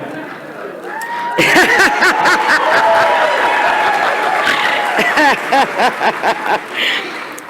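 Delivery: a high, breathless laugh through tears; embarrassed teary laugh; teary breathy laugh. Real, not acted. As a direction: laugh while choking up.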